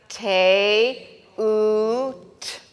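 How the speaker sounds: te oot, sounded out slowly syllable by syllable; slow sounding out. Shown as a demonstration. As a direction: spell out te oot in two slow beats. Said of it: "So you're gonna say".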